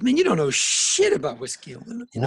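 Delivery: a hint of an old-timer setting the kid straight; mild mock scold; scolding old bluesman. Bluesman voiced in story.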